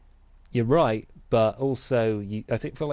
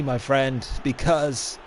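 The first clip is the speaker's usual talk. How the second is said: usual speaking voice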